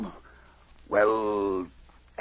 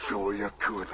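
ordinary talk

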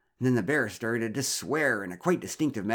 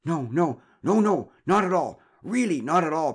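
high, loud flustered denial; loud protest; 8 semitones higher, 8 dB louder than usual. Acted. Flustered husband denies smoking to a neighbour, protesting loudly. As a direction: protest far too much. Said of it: "protested Derek".

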